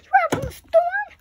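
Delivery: very high, loud gasping doll cry; shocked gasp. Pretend.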